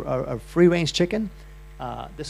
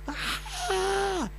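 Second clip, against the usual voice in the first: high startled scream; a sudden high yelp of fright; 5 dB quieter than usual. Retold or imitated. Seeing chicken feet in the pot, a high scream. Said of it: "all of a sudden I scream".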